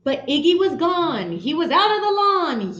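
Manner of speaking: ordinary delivery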